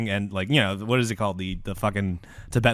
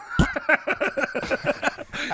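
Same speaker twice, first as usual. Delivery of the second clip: raspberry; 4 dB quieter than usual. Retold or imitated. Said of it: "blow a quick comedic relief raspberry".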